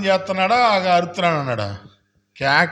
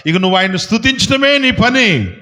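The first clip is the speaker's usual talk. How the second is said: full-force preaching; 7 semitones higher, 5 dB louder than usual. Real, not acted. Pastor urging the congregation to praise, preaching at full force. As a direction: preach it out, driving each line home.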